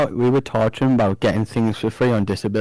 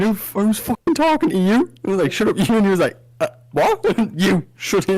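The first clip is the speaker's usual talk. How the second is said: mock retort; 7 semitones higher than usual. Acted banter with dad's mate.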